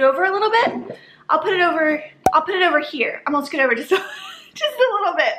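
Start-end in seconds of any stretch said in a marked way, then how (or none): 3.7-5.3 laughing. Real, not acted. Laughing while moving over.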